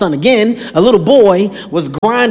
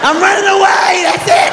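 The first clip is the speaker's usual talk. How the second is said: put-on kid's tantrum; 10 semitones higher than usual. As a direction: announce it like a hurt child. Kid storming off.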